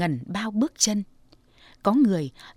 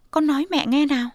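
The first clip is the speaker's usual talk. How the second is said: coaxing mother; 6 semitones higher, 4 dB louder, faster than usual. Acted. Mother coaxes.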